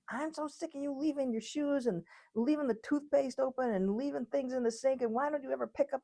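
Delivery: high-pitched, run-on scolding; mock nagging yell. Spouse chewing you out as you relax, high nagging yell. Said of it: "starts yelling at you".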